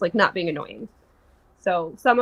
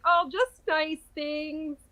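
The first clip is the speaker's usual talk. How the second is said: put-on little girl voice; 8 semitones higher, much slower than usual. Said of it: "And they'd be like".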